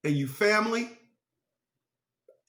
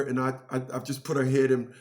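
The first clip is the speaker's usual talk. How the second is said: ordinary delivery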